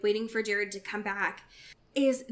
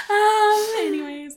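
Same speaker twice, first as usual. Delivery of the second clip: laughing off embarrassment; 10 semitones higher, 7 dB louder than usual. Real, not acted.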